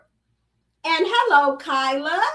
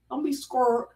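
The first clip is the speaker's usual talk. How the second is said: Texas accent; 4 dB quieter than usual. Shown as a demonstration. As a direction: say it the Texan way, a touch of Texas. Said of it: "like they say in Texas".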